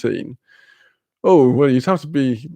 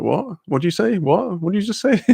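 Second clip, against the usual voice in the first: retold bafflement; 6 semitones higher than usual. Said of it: "every other word I'd be like".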